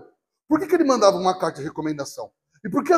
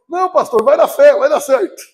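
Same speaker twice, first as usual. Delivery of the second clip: higher, louder pushy encouragement; egging his pastor on to drive; eager urging; 6 semitones higher, 7 dB louder than usual. Retold or imitated.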